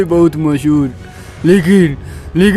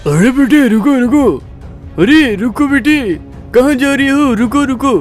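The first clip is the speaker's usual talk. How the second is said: urgent, higher-pitched call to stop; 4 semitones higher than usual. Acted. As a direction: call after someone leaving, pitched up. Uncle calls after departing boy. (पीछे से पुकारते हुए)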